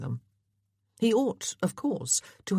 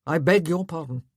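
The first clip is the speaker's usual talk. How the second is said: loud, petty begging of pardon; 5 dB louder than usual. A narrator's reading. Husband to his frowning wife, petty. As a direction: take offence pettily. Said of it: "still petty and unable".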